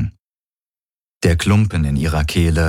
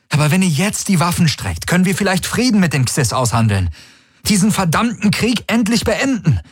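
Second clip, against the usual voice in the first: high, pressing plea that climbs toward the end; urgent appeal; 9 semitones higher than usual. A narrator's reading. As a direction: appeal urgently to stop a war. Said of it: "sah die Dringlichkeit in seinem Gesicht, hörte sie in seiner Stimme". (eindringlicher Appell, hohe Stimme)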